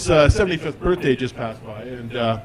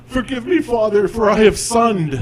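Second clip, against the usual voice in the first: higher solemn confessional tone, slowing at "sunned"; mock confession; 8 semitones higher, 4 dB louder than usual. Confessing to the priest, mock-solemn penitent voice. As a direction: play it penitent, land the pun.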